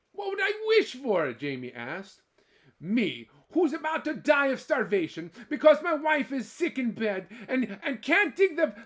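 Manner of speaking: high, loud self-pitying whine; whining Jamie. Acted. Jamie whining to the leprechaun, high and loud. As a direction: complain about your hard lot.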